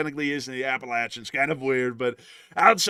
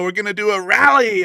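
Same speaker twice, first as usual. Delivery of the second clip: ordinary delivery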